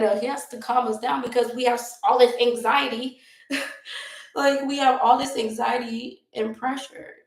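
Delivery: normal talking voice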